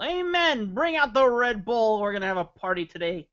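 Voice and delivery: loud, high hyped-up shout; mock party cheer. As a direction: whoop it up like a rowdy party starter. Cheering a viewer's Red Bull comment.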